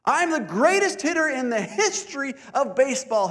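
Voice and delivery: high, loud proud boast; little boy bragging before his swing; boasting kid. Acted. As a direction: crow it like a cocky kid. Boy boasts a third time.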